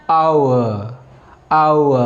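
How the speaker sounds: आउ as a slow aa-oo glide; careful au sound. Shown as a demonstration. Teacher drilling the triphthong sound, careful. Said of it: "इसे बोलते हैं".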